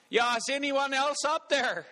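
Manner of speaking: high-pitched comic call for other help. Acted. Dangling man seeks another rescuer, high-pitched calling out. Hanging man rejects God's answer, calls again.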